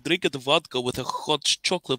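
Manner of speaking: put-on Russian accent; playful impression of a Russian speaker. Shown as a demonstration. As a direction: do your best comic Russian accent. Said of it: "my best impression of the Russian accent".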